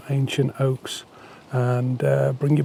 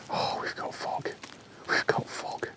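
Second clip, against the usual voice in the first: whispering; 12 dB quieter than usual. Real, not acted. Excited about fog in whisper.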